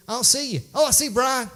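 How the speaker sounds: high, loud mimicked calling out; acted-out phone caller. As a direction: imitate someone searching a crowd, then spotting a friend. Acts out Amber on the phone.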